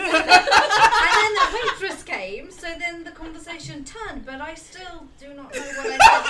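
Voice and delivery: loud, laughing through the words. Real, not acted. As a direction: speak while cracking up. Laughing while telling story.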